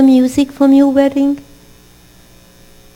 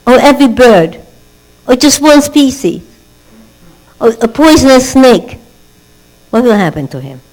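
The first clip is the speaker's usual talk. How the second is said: louder sermon voice, driving the warning home; fiery preaching; 3 semitones higher, 7 dB louder than usual. Acted. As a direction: preach it out to the children with urgency. Rabbi preaching at full force.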